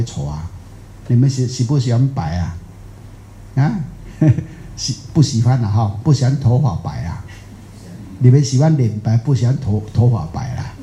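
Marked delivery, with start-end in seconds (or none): none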